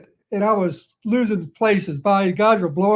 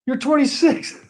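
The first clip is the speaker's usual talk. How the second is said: coach calling out; 6 semitones higher than usual. Retold or imitated. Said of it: "he says".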